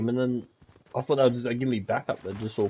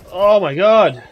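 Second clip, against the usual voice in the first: loud disbelief; 5 semitones higher, 12 dB louder, slower than usual. Real, not acted. Reacting to fast enemy.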